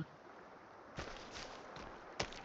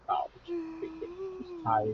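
whiny pet voice; 12 semitones higher, 5 dB quieter, much slower than usual. Pretend. Voicing the tired pet.

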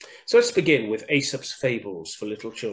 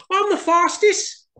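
panting Hare; 6 dB louder than usual. Acted. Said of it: "panted Hare".

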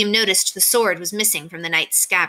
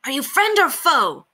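high, mildly shouted challenge; high-pitched knightly demand; knight's shout; 9 semitones higher, 4 dB louder than usual. A narrator's reading. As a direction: challenge them as a tiny, feisty knight. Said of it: "the knight shouted".

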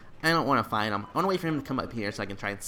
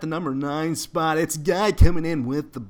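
higher, announcing a ranking countdown; commentator voice; 3 semitones higher, 3 dB louder, slower than usual. Acted. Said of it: "I'm doing that commentator voice".

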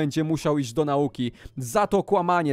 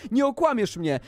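ordinary delivery

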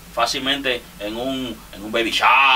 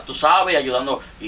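ordinary talk, nothing marked

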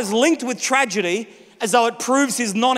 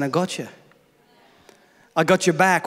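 tender reassurance; 5 semitones lower, faster than usual. Pretend. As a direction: comfort someone close. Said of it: "It's God saying".

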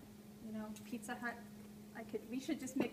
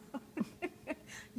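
breathy giggle; short real laugh; stifled laughter; 3 semitones higher, 6 dB quieter than usual. Real, not acted. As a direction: crack up mid-plea. Cracks up mid plea.

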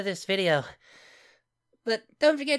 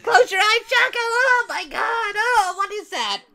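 horrified shrieks; 9 semitones higher, 7 dB louder than usual. Real, not acted. Horrified screaming at gross clip.

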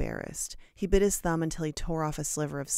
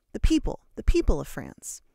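loud emphatic explanation; 2 semitones higher, 7 dB louder than usual. A narrator's reading. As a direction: proclaim it to the class.

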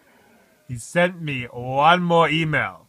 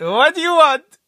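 high, loud mock snap; mock annoyed coworker; 13 semitones higher, 10 dB louder than usual. Walking up to the emailing colleague, high loud snap. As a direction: play the startled colleague barking back. Acting coworker's reaction at desk.

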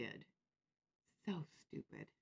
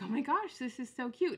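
higher-pitched retold gush; gushing compliment; 7 semitones higher, 3 dB louder than usual. Shopper complimenting a crafter's necklace, gushing. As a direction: admire it in a high, delighted voice. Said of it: "like, complimenting her".

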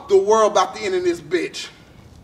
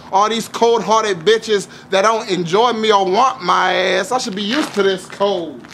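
usual speaking voice

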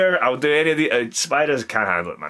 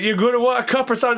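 quick, high-pitched, louder question; retold nervous urgency, pitched up and rushed; mock anxious question; 9 semitones higher, 5 dB louder, much faster than usual. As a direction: ask fast and high, imitating your rattled self. Retold panicked question to rescuer.

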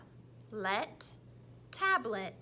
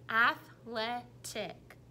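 athletic slowly split into ath, le, tic; chopped into syllables; 3 dB louder, much slower than usual. Shown as a demonstration. Teacher showing the kids the syllable chops. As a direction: chop athletic into three slow beats. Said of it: "supposed to be making the choppers".

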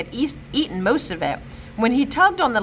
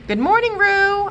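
cheerful greeting; 12 semitones higher, 4 dB louder than usual. A narrator's reading.

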